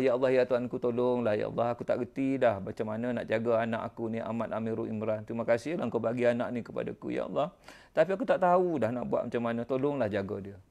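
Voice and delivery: low, soft, pleading to God; humble prayer. Retold or imitated. Father begging Allah to look after his son, soft and low. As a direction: pray quietly from a heavy heart.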